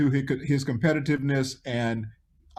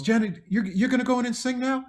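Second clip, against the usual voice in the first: Michael impression; 10 semitones higher than usual. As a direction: lift the voice into a gentle high Michael. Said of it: "Michael goes".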